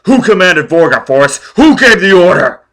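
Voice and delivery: holding him underwater, bellowing; savage shout. Acted. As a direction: roar it over the surf. Foyle drowning Forrest, shouting.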